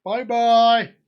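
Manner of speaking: hammed-up childlike bye; sing-song goodbye. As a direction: wave off Mister Egg sweetly.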